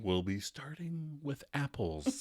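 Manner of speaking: slow, soft game show host; a hint of a TV host announcing; lightly voiced quiz host, slowed down. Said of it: "[name] does the voice again".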